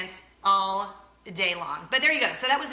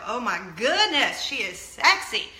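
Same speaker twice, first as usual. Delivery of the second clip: louder, drawn-out mock swoon; swooning admiration; 5 dB louder than usual. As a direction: gush as an imagined smitten listener. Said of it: "that you just go".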